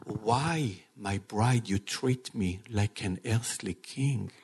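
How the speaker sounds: calm, loving voice of Jesus speaking to his bride; gentle reassuring Jesus. Acted. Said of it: "what the king told Jairus?".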